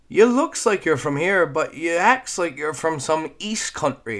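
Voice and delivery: put-on high, loud excitable kid rattling on; eager little girl who won't stop talking; chatty Newfoundland girl. Voicing Squeak.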